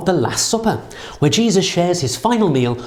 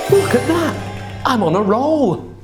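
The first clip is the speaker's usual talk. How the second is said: excited; 8 semitones higher than usual. Real, not acted. Reveal of the bread.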